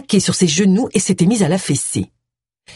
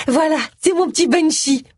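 high, doting introduction; high-pitched, perky wife voice; gushing wife; 10 semitones higher than usual. A narrator's reading. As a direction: show off the husband in a high voice. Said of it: "lança Amy".

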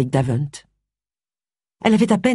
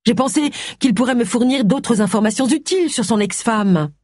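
higher-pitched self-justifying voice; lightly voiced defensive Agatha; 8 semitones higher than usual. Agatha explaining the dinner to Charles, mildly defensive.

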